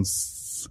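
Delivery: quiet unvoiced hiss; just hiss the S; whispered snake hiss. Shown as a demonstration. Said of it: "putting an S on shit and then going".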